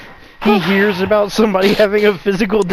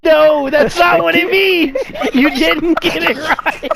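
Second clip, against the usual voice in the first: higher-pitched real protest; exasperated correction; 8 semitones higher than usual. Real, not acted. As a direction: shut down the wrong guess, drawing it out. Correcting friend's absurd guess.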